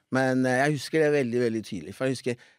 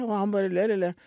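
ordinary talk

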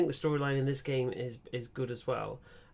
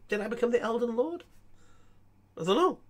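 rising, high baffled question; baffled disbelief; 8 semitones higher, 4 dB louder than usual. Real, not acted. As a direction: ask it like you truly can't believe it. Baffled about becoming Elden Lord.

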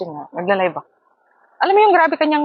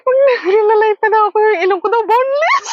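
high, louder laughing wail; laughing while crying out; 11 semitones higher, 5 dB louder than usual. Real, not acted. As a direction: laugh through the words in disbelief. Laughing at the insult. (natatawang pasigaw)

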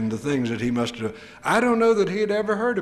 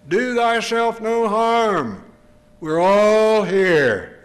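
slow, loud and higher, calling across the jail; Peter calling out; 7 semitones higher, 6 dB louder, much slower than usual. Acted. Peter shouting to the jailer not to harm himself, slow and loud. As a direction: call out slowly to stop him.

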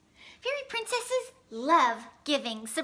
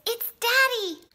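high, loud delighted cry; happy surprise; 8 semitones higher, 6 dB louder than usual. A narrator's reading. Girl sees Daddy arrive at class, delighted and surprised.

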